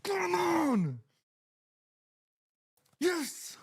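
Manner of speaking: high, drawn-out cry of triumph; exultant cheer. Real, not acted. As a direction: cry it out high, falling at the end. Celebrating the goal.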